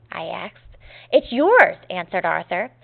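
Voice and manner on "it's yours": high, loud quoted answer; storybook dialogue with a loud lift on "yours"; emphatic answer. A narrator's reading. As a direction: answer brightly and firmly, pitched up on YOURS. Boy's answer in story read aloud.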